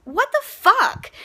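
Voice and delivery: high, slow, stunned outrage; disbelieving what the fuck. Retold or imitated. Mom-to-be reacting to the show, high and stunned. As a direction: let it rise in shocked disbelief. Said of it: "I'm like".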